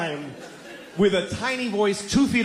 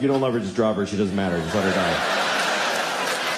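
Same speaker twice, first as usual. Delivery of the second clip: inner voice; 12 semitones lower than usual. Retold or imitated. Brain urging dad to drop child, low tempting voice. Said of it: "sending signals to my brain like".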